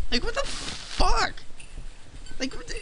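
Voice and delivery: high, slowed squeak of disbelief; hammed-up falsetto outrage; mock outraged squeal. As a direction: squeal it like you can't believe your eyes. Mock outrage at a rip-off game.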